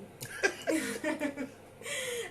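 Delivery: high, drawn-out laugh at her own joke; amused laugh. Real, not acted. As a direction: laugh brightly after the quip. (risada divertida)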